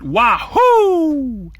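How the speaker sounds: high, loud whoop of triumph; thunderous shout. Acted. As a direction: burst free with a big yell. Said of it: "wailed a thunderous shout".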